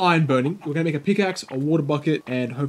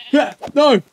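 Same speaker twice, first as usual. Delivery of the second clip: usual speaking voice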